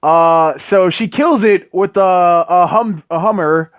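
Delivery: normal talking voice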